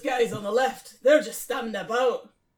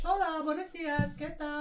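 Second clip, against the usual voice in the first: higher-pitched stock Spanish greeting; Spanish accent; 5 semitones higher, 5 dB quieter than usual. Shown as a demonstration. Listing warm-up phrases for accents, Spanish accent. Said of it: "oh, Spanish".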